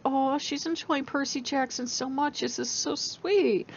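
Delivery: soft, high, cooing mock voice of well-meaning friends; mock sweet gushing. Friends gushing about her reading, soft mock coo. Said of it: "everybody was like".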